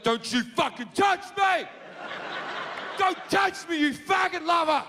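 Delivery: high, shrieking outrage; furious God shout. Acted. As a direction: push them away screaming. God shouting at calming partygoers.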